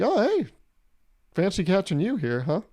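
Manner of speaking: high, sing-song "fancy meeting you"; feigned surprise greeting. Acted. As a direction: act pleasantly surprised to bump into a coworker. Acted awkward run-in in bathroom.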